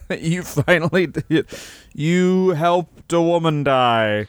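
slow, drawn-out fatherly pronouncement; proud dad. Retold or imitated. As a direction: savour each word like a proud mentor. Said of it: "his dad probably was like".

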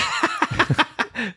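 high squealing laugh. Real, not acted. Laughing at producer name.

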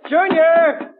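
high, rising call for Junior. Acted. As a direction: call the boy from across the house. Father calls his son.

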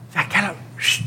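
a secretive whisper, inviting him along; hushed whisper. Retold or imitated. Friend urging him to the club.

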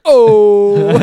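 hooted whale call, very loud; deep singing whale. Retold or imitated. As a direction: mimic a whale's moan. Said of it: "trying to do a whale sound".

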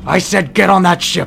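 loud, high-pitched furious shout; terrifying command. Acted. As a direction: yell the order to scare him witless. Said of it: "he's trying to terrify this guy".

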